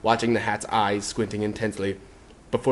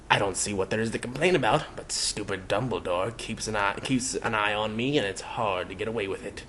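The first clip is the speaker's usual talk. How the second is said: grumpy; 7 dB quieter than usual. Acted. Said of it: "the hat finished grumpily".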